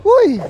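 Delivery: high, loud whoop of joy; excited whoop. Real, not acted. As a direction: let out a thrilled cheer. Cheer after the finish.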